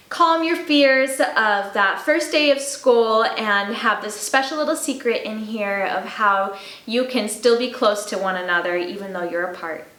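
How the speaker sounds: ordinary delivery, nothing marked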